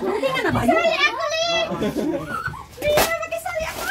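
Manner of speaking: hammed-up childish whine to be let in; playful kiddy voice. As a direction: beg to join in like a little kid. Put-on childish voice, pleading to join.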